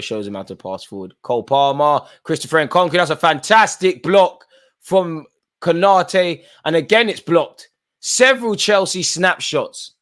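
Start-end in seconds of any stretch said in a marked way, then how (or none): 1.2-9.9 rising, loud, stretched-out live call; excited commentary. Real, not acted. Streamer calling Chelsea's late chance to viewers, excited commentary. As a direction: call the goalmouth scramble like a TV commentator.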